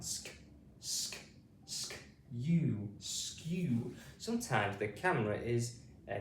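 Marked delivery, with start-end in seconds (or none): 0.0-1.2 "Sk" said alone as one blended sound, twice; blend drill. Shown as a demonstration. Teacher isolates the sk blend for pupils, drilled. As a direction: say "Sk" short, with no vowel. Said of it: "to make the sk sound".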